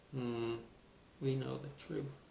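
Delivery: usual speaking voice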